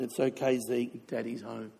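low, hushed fatherly reply, read aloud; tender reassurance. Retold or imitated. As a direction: soothe softly as the poem's father. Reads dead father's comforting poem reply.

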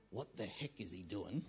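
low, quiet aside in someone's head; private muttered thought. Pretend. Preacher voicing Dave's thought about the offering, low puzzled mutter.

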